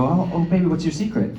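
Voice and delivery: soft, tender asking; gentle coaxing. Retold or imitated. Parent kneeling to ask the child's secret, softly.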